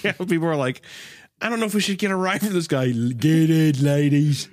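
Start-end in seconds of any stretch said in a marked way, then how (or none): 1.4-2.9 worried passenger voice. Pretend. Imagined wary passengers. 2.9-4.5 loud, leering creep voice; silly creepy voice. Pretend. Voicing the flesh car.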